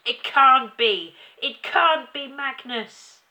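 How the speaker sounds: slow, high, very loud denial; screaming. A narrator's reading. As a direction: cry out in horrified disbelief. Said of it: "she screamed".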